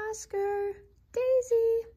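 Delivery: high, loud call across the snow. A narrator's reading. Owners calling their dogs home, high and loud. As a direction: call the lost dogs' names.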